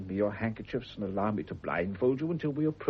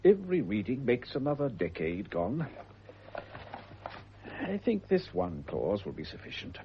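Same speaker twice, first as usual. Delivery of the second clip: ordinary talk, nothing marked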